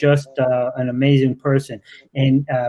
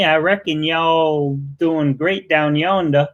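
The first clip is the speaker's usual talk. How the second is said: slow, drawn-out mock Southern accent; put-on Southern twang, stretched out slowly; imitated Southern accent. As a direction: tease him with a playful Southern accent. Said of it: "Or that accent. That accent, that's what gets me".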